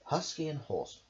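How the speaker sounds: ordinary delivery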